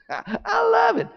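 gleeful fish. Acted. Fish gulps cricket.

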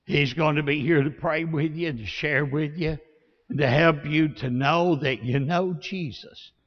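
low, soft pastoral appeal; altar-call invitation. Real, not acted. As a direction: gently invite them to open their hearts. Leads altar invitation and prayer.

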